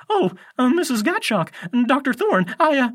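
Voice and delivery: very high, loud, stammering; flustered. A narrator's reading. Grover suddenly seeing the teachers, flustered. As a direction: fumble for words, caught out in a high voice.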